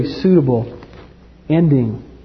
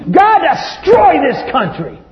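high, loud, exasperated cry at God; imitating an angry believer; fed-up outburst; 5 dB louder than usual. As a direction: vent your frustration. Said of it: "you know what you think".

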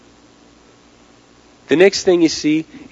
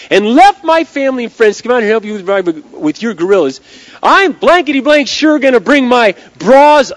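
loud, high-pitched acted tirade; very feisty; 8 semitones higher, 9 dB louder than usual. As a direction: tell him off at full volume, building from firm to shouting. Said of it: "She gets very feisty".

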